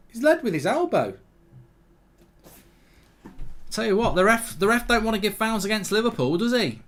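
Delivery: high, aggrieved grumble at the ref; indignant complaint. Real, not acted. Complains about referee favouring Liverpool.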